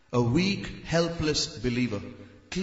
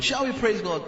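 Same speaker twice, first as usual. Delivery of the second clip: mock pious invitation; 5 semitones higher than usual. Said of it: "that's all stage drama".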